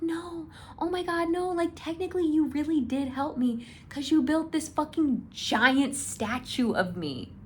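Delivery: lightly voiced Ji Lian brushing it off. Ji Lian answering Hua Cheng.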